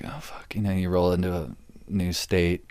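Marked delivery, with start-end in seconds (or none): 0.0-0.5 breathy whispered "oh fuck" under the breath; whispered dread. Retold or imitated. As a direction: whisper it to yourself, dreading the arrival. Inner reaction, nervous.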